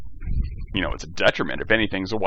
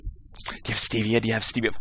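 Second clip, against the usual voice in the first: paranoid; 6 dB quieter than usual. Retold or imitated. Mocking the Breaking Bad character ordering stevia, hushed and paranoid. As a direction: ask it softly like someone afraid of being poisoned. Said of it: "And she was all paranoid and shit".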